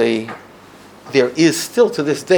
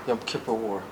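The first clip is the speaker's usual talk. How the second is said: ordinary delivery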